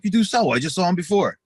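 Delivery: acted-out accusing comeback; insistent contradiction. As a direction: argue back, calling out the lie. Retold argument over pills.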